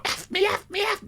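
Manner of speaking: hammed-up lover crying out in the heat of the moment; mock passion. Husband imitating himself and his wife mid-passion, hammed-up high moan. Voicing passion during sex.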